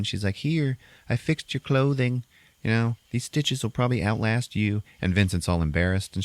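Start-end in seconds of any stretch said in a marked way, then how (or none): 0.4-4.8 a hint of a kindly woman; nice townswoman. Townswoman returning mended clothes, lightly voiced kindness. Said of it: "this nice little townswoman comes in".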